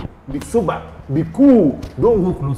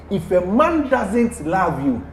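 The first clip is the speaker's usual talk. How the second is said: ordinary talk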